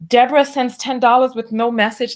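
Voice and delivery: usual speaking voice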